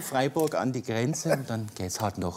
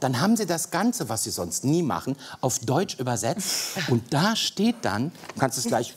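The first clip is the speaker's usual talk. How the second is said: normal talking voice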